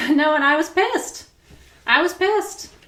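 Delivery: exasperated laugh-shout; excited outburst. Real, not acted. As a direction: pitch it way up on pissed. Reliving anger, laughing.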